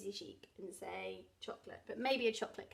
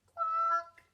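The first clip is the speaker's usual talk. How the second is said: parrot squawk; 6 dB louder than usual. Acted.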